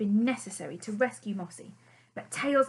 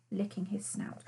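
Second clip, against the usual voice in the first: usual speaking voice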